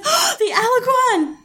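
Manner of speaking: high, delighted gasp of recognition; excited. Retold or imitated. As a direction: squeal it like spotting an old friend. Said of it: "I got very excited".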